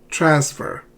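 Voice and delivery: "Transfer" stressed on the first syllable. Shown as a demonstration. Teacher repeating the model for learners, "Transfer" with first-syllable stress. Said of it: "not transfer".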